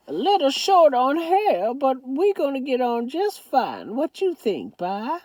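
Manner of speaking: put-on high old Southern grandmother voice; Southern grandma. A narrator's reading. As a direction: scold lovingly as a granny.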